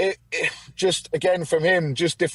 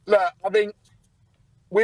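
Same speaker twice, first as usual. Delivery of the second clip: normal talking voice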